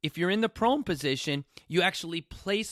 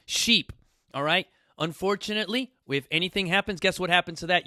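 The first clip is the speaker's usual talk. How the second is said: ordinary talk, nothing marked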